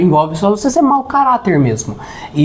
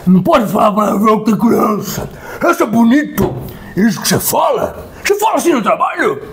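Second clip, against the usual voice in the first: hammed-up relative playing table police; scolding relative; 3 semitones higher than usual. Relative Jorge scolds the narrator at Christmas dinner, mock outrage. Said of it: "Aí o parente distante, Jorge".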